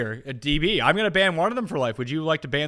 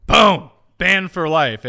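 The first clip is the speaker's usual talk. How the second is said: emphatic pronouncement; 3 semitones higher, 10 dB louder, much slower than usual. Pretend. Announcing a ban with relish.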